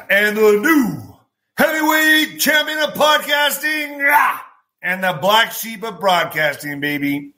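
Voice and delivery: slow, drawn-out boxing announcer voice, rising to high shouts; hammed-up announcer hyping the podcast host; ring announcer intro. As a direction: announce himself like a title fight. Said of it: "podcast sign-off announcer intro".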